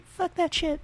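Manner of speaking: put-on small daughter blurting a swear word, high child voice; little girl cursing. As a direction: squeak it out like a little kid swearing. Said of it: "Little Missus Hammer come down and say".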